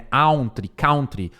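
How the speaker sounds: "country" with OW, "cown-tree"; common mispronunciation. Shown as a demonstration. Teacher imitating everyone's error, OW vowel in "country". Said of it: "Todo mundo fala country".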